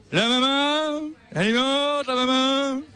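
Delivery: a hint of an old French crooner; [name]. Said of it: "c'était une imitation de [name]".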